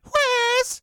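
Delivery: very high, loud imitated squeal; sudden cartoonish high squeak; wide-eyed squeal. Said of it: "like, wide-eyed".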